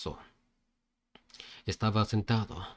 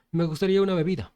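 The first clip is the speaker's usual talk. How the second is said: cheerful request; 7 semitones higher, 4 dB louder than usual. Acted. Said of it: "con una sonrisa".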